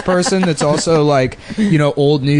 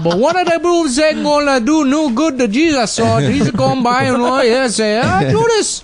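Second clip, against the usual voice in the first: much higher, hammed-up Gullah storyteller voice; mock Gullah accent; 11 semitones higher than usual. Retelling the Gullah New Testament to friends, mock Gullah accent. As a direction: read scripture like a sing-song Gullah preacher. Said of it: "translated the New Testament into Gullah".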